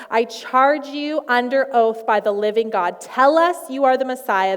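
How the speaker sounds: forceful, declamatory order; commanding demand. Acted. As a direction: demand it like an interrogator under oath. High priest interrogating Jesus.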